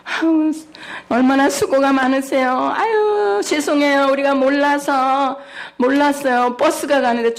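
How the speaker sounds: butter up the policeman with charm; acting cute. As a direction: youngest daughter sweet-talking the traffic officer to avoid a ticket. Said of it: "애교를 떠는".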